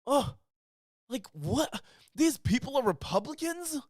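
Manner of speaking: rising, incredulous gasp of a question; mock shocked disbelief. Co-host mocks the guest's stunned disbelief. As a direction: play the stunned man finding out his own side. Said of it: "and he's like".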